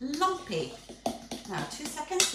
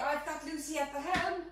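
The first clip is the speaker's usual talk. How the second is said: usual speaking voice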